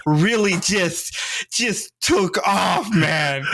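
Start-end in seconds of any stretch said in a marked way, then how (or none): 1.5-3.4 slow, drawn-out laughing shout, higher. Real, not acted. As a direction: stretch it out as the laugh breaks through.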